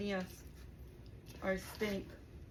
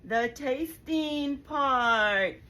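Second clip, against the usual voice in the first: excited; 4 semitones higher, 6 dB louder, slower than usual. Real, not acted. Building up to the tasting.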